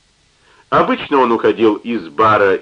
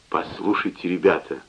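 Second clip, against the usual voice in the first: softer conspiratorial hush; 4 semitones lower, 7 dB quieter than usual. Acted. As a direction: lean in like sharing a secret. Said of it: "начал он с заговорщическим видом".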